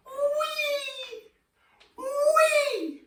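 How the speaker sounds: very high gleeful whee; high sliding whee down the swing. Pretend. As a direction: squeal like a child on a swing. Pretending to ride a swing.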